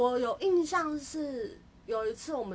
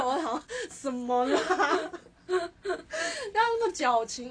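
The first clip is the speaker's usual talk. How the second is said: high, slow giggling half-sob; laughing embarrassed; 7 semitones higher, 5 dB louder, much slower than usual. Real, not acted. Recalling her sappy text to a friend, laughing and cringing.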